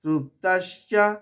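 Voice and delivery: "তৃপ্তস্য" slowly, with p struck before t; stressed p. Shown as a demonstration. Teacher showing the struck p, slow "তৃপ্তস্য". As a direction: intone "তৃপ্তস্য", leaning on the clusters. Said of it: "পয়ের আঘাত".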